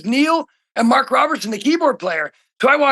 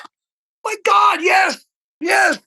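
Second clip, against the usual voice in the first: overjoyed; 8 semitones higher, slower than usual. Retold or imitated. Drummer recalling hearing he could audition, overjoyed shout. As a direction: burst out with joy.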